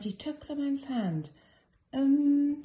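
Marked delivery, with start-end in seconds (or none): 1.9-2.6 hesitant um. A narrator's reading. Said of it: "said the man as he rubbed his head".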